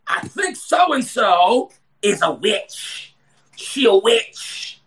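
slow, drawn-out imitation of a gossip; mock suspicion. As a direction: stretch it out like a scandalous accusation. Acting out a suspicious churchgoer's gossip.

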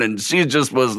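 ordinary talk, nothing marked

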